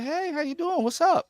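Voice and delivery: high-pitched imitated hello; lightly sung-out, high greeting; mock cheery greeting. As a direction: imitate her, high and breezy. Imitates actress greeting him warmly.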